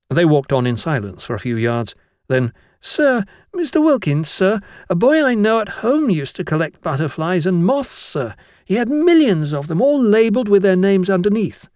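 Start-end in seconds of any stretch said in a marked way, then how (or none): 2.8-11.7 high-pitched, eager little schoolboy; chattering schoolboy. Acted. Blotwell asks his master about butterflies, high schoolboy voice. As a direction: pipe up politely as a young pupil.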